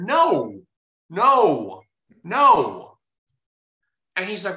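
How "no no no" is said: higher, louder, each no on its own; retold emphatic refusal. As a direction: lay down a firm, falling no each time. Retold firm refusal to the man.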